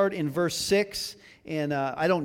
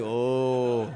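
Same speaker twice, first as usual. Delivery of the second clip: low, drawn-out oh; a long, sinking oh; mock dawning realization; 5 semitones lower than usual. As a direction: imitate the guys' belated oh. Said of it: "You guys were like".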